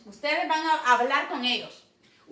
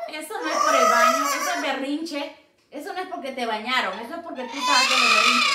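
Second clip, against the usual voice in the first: loud, fast scolding; 8 dB louder, faster than usual. Real, not acted. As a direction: tell her off loudly and quickly. Regaña al loro por berrinche. (regaño fuerte y rápido)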